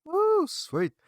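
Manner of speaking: Woo shot up very high; happy high whoop from the host; excited whoop. Real, not acted. Host cheers guest's thanks.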